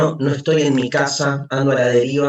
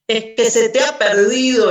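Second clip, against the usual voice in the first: loud, higher jeering call; a scoffer in the crowd shouting loud and higher; mocking shout; 8 semitones higher, 7 dB louder than usual. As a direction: yell it to ridicule the madman. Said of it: "le gritaba uno".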